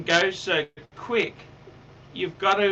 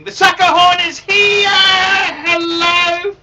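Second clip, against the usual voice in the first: shouted, high and loud hype; big excited shout into the mic; loud announcement; 9 semitones higher, 10 dB louder, slower than usual. Acted. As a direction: yell it out like a carnival barker. Calling out on broadcast.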